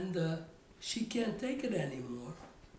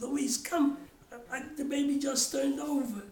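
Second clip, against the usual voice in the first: hollering to wife; 5 semitones higher than usual. Retold or imitated. Calling his wife in to see the baby roll, excited holler. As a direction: call her over, thrilled at the news. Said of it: "I hollered out to your mother".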